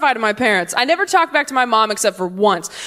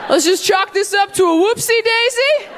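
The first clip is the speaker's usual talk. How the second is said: mock breezy parent; 9 semitones higher than usual. Parent covering up.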